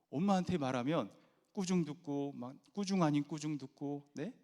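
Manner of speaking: ordinary talk, nothing marked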